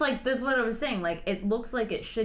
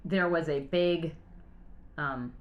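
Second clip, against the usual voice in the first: normal talking voice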